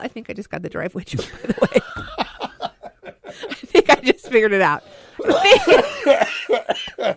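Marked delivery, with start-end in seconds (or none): 1.6-7.2 laughing through the words; giggling while talking. Real, not acted. Laughing as she gets joke.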